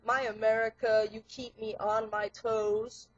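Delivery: punchy rhymed verse, stressed on the beat; rhythmic spoken-word poem. Acted. Performer reciting a satire on fat America, rhythmic spoken word. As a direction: recite it like rap without music.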